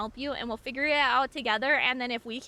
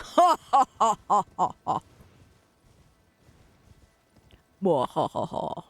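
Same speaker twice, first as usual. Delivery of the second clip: low evil mwa-ha-ha; villain laugh; 5 semitones lower, 3 dB louder than usual. Acted. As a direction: cackle like a mad scientist. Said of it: "Why are you doing that voice".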